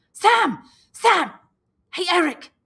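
high, loud shouts across the shelter; boy yelling at the struggling twins; shouted names. Acted. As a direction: yell to break up a fight. Calling the fighting twins.

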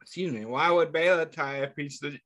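usual speaking voice

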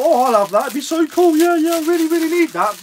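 mock himself gushing over a gadget; high, childish eager voice; imitating own impulse buying. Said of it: "I thought".

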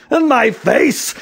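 high, loud, drawn-out complaint; exasperated cry. A narrator's reading. Policeman with hair on his face, exasperated. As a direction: wail it loudly in exasperation.

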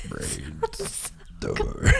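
strange burped alien voice. Shown as a demonstration. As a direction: croak it out like an alien. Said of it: "That's a freaking alien".

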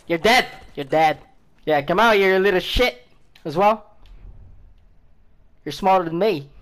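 ordinary talk

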